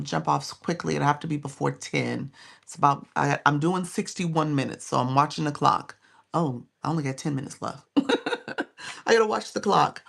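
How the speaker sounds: ordinary talk, nothing marked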